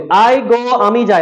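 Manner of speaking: I go said very slowly; slow model. Shown as a demonstration. Teacher models I go slowly for students to repeat. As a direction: spell it out slowly for the class. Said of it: "আবার বলি".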